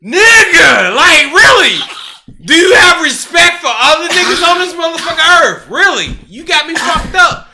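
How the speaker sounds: high, loud, crying-out rant; hammed-up furious yelling; retelling his outrage at the other man, yelling high; mock outraged shout. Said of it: "love the sound effects".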